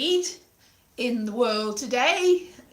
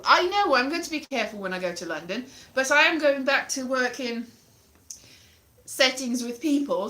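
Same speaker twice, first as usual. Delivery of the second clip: ordinary delivery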